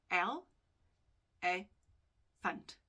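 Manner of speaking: "L, A, funt" as three separate sounds, long pauses between; split into syllables. Shown as a demonstration. Teacher showing students three syllables, split apart. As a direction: break elephant into "L", "A", "funt", one at a time. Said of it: "it's three specific sounds".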